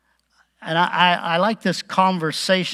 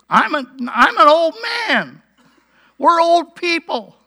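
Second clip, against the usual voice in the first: high, whiny mimicry of an excuse; exaggerated mock-helpless complaint; mocking echo; 10 semitones higher, 4 dB louder than usual. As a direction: throw their words back in a silly high voice. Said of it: "all you can say is".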